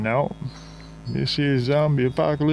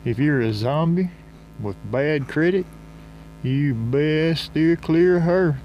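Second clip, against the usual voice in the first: normal talking voice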